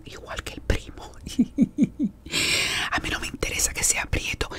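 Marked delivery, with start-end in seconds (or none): 1.2-2.9 whispered laugh. Real, not acted. Laughs at the gossip.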